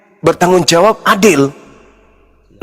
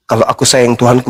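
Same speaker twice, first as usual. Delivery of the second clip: normal talking voice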